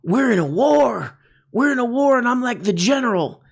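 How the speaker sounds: high, hammed-up wartime declaration; play-acting a general. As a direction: declare it like an amateur actor playing commander. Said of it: "little acting experiment they're doing".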